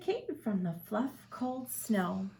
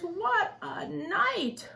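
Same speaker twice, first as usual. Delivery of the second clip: mole muttering; 8 semitones higher, much slower than usual. Acted. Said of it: "Mole mutters".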